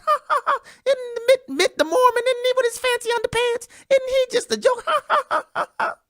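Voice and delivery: high, laughing mockery; mocking laugh. The world mocking Romney, high laughing voice. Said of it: "Belly laughs".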